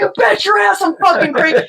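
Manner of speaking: high, manic shouted comeback; re-enacted unhinged yell; out-crazying them. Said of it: "you just gotta out crazy them".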